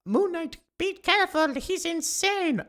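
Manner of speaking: very high-pitched, put-on woman's voice; Spanish lady. Lady warning Moon Knight of danger, high put-on Spanish lady voice. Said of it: "did a Spanish lady".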